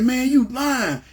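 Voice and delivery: high, slow, drawn-out accusation; accusing friend. Retold or imitated. Friend in the car accusing him of lying, high and slow. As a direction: stretch it out, disbelieving.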